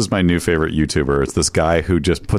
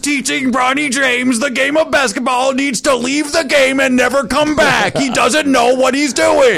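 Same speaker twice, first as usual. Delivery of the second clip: yelling YouTuber; much slower than usual. Retold or imitated. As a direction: rant at the player at full volume. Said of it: "he just screams the whole time".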